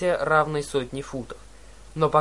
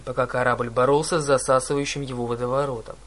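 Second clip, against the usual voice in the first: ordinary delivery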